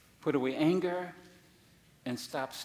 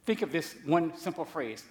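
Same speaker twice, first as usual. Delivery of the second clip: usual speaking voice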